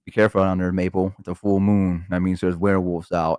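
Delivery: low, hushed-down caution to a pet; mock-ominous warning. Owner warning the dog under full moon, low voice. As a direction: drop low as if sharing a spooky warning.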